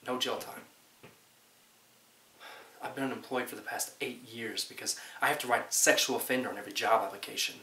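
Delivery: ordinary delivery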